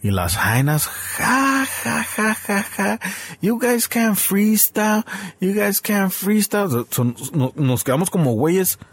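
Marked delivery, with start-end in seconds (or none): 0.9-3.0 high, mocking ha ha ha; a teasing imitation of laughing girls; mock girls' laughter. Girls laughing at the drunk rappers, mock high laughter. 3.4-6.8 high, slow taunting sing-song; mocking girls' taunt. Girls mocking the guys who can't rap, slow high taunt.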